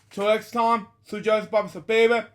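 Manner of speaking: high, loud, playful outro; lively farewell to viewers; excited sign-off. Real, not acted. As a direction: sing out the goodbye with energy.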